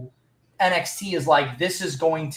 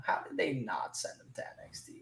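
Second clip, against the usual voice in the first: hushed, breathy whisper; 3 semitones lower, 16 dB quieter than usual. Real, not acted. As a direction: whisper the question in disbelief. Repeats the question, whispered.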